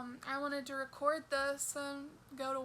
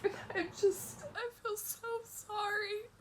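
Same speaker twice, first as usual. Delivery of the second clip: sobbing, higher tearful voice; blubbering; 3 semitones higher than usual. Real, not acted. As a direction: let the sobs break the words. Crying about partner's burden.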